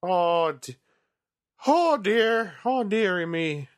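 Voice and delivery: high, fussy "oh dearie me"; flustered old granny, lightly voiced; old lady fluster. Hammed-up fussy exclamation, far voice.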